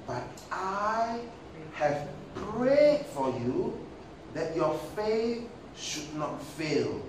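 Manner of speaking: slow, solemn scripture voice; Jesus. Acted. Jesus telling Simon he has prayed, slow solemn address. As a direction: draw each phrase out slowly, with weight. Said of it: "Jesus said".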